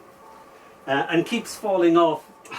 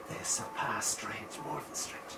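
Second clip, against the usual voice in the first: breathy hushed whisper; 6 semitones lower, 14 dB quieter than usual. Shown as a demonstration. Poet explains the phrase, whispering. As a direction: let it fall to a whisper.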